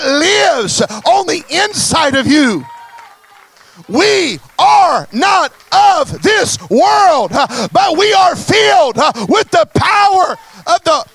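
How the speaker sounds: rising, shouted preacher's cry; preaching at full force. Acted.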